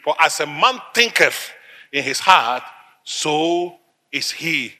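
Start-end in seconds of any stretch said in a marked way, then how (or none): 3.0-4.8 soft, very slow close; hushed, drawn-out pronouncement; solemn scripture ending. Real, not acted. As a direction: let the verse land quietly. Preacher lands scripture quote softly.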